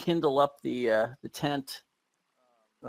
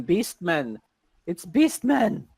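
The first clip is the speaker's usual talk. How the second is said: high, louder panicked scream; fleeing woman screaming about beastmen; 4 semitones higher, 4 dB louder, slower than usual. Acted. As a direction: scream it as she runs past. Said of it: "she's screaming".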